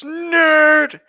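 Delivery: sudden high, loud heckling yell; a heckler in the background shouting at Edward. Acted. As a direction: yell it across the room to mock him. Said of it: "somebody shouts".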